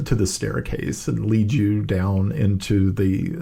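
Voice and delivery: normal talking voice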